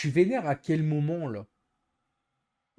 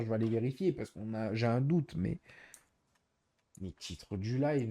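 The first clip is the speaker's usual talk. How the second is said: ordinary talk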